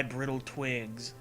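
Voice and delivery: normal talking voice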